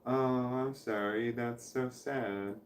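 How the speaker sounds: very soft, slow, fading pity; mock sad sympathy. Shown as a demonstration. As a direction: breathe it out slowly like scripted condolence. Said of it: "Why do I have to say it all".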